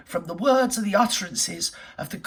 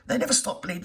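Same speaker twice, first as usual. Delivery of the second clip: ordinary talk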